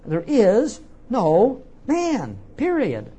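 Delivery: "There is no man, period" high and loud, word by word; emphatic. Acted. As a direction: stretch out "There is no man", then land "period" firmly. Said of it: "he's putting an emphasis upon that".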